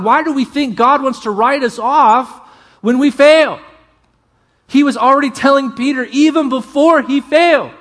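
rising preaching. Real, not acted. Preacher pressing the congregation, loud and high. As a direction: preach it with mounting fire.

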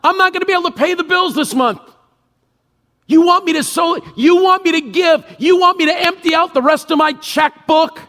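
incredulous, panicked shout at God; exasperated protest. Pretend. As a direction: blurt it out, aghast at the ask. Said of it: "your first thought is".

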